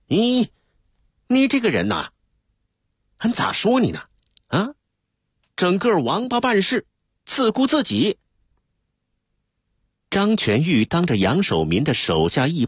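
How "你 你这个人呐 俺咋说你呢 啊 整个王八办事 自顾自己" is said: high-pitched furious scolding. A narrator's reading. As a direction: tear into him, voice pitched up. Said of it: "遭到了张全玉的痛批". (厉声痛骂)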